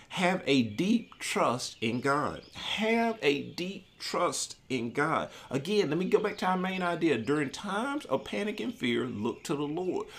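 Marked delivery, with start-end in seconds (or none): 0.1-5.3 slow and weighty, word by word; slow emphasis. Shown as a demonstration. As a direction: let each word of the point land slowly. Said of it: "repeats point slowly".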